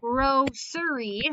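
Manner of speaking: grocery drawn out slowly, syllable by syllable; teacher sounding out grocery slowly; slow syllable demo. Shown as a demonstration. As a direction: stretch grocery so each syllable is heard. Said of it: "Now, if I say it".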